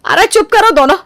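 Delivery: high, sharp call to stop; sharp scolding. Acted. Mother-in-law scolds both daughters-in-law, sharp and high. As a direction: cut the fighting short.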